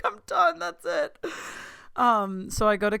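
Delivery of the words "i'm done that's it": very high, squeaky retold declaration; overjoyed squeal. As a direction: squeal it, then let it sink a little. Overjoyed, retelling being done.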